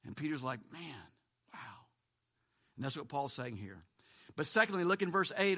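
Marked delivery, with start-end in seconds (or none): 0.7-1.9 very soft, low murmur of wonder; hushed awe. Retold or imitated. Preacher voicing Peter marveling at people saved, hushed. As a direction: drop right down, as if awestruck.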